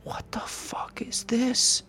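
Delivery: high, slow, breathless whispered fear; whispered horror at the shadow; frightened whisper. Retold or imitated. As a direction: hiss it out in creeping terror. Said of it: "I'm like".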